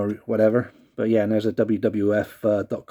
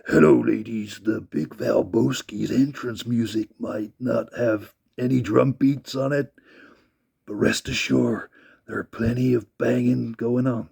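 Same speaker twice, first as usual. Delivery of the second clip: lightly voiced ladies' man; Val Venis impression. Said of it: "I sounded more like Macho Man".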